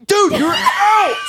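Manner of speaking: very high, drawn-out retold shout; exasperated mock yell. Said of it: "like, yelling".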